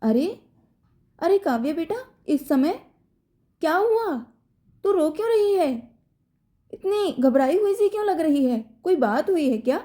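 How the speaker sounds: a frightened mother rushing to her daughter; alarmed asking. A narrator's reading. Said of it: "उन्होंने घबराकर पूछा".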